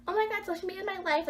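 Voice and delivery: high, fast, gushy mock teen; put-on squeal of teenage devotion; typical teenager parody. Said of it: "like a typical freaking teenager these days".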